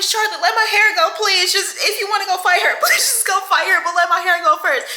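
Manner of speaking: put-on girl with hair held begging Charlotte to let go; mock desperate pleading. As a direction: beg her to let go of your braid. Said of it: "I'm like".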